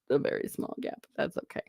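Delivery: a very small gap whispered, voice dropped to a breath. Shown as a demonstration. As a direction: hush it down to nothing on "a very small gap". Joking about a small gap.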